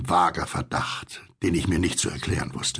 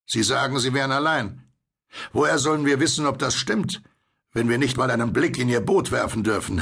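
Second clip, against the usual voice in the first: higher, challenging call over the rail; 5 semitones higher than usual. A narrator's reading. Will challenges the stranger in the boat, calling out. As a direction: call down suspicious questions. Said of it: "beugte sich weit über die Reling und rief".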